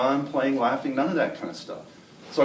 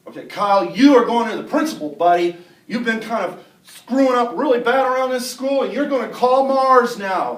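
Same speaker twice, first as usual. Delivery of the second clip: loud, raised, put-on telling-off; stern scolding; 7 semitones higher, 9 dB louder than usual. Classmate pretends to march Kyle to principal, loud scolding. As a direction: announce it loudly for onlookers to hear. Fake scolding before the watching kids.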